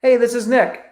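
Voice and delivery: high, bright greeting; upbeat. Shown as a demonstration. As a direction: sound glad to reach him. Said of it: "You hear my tonality?".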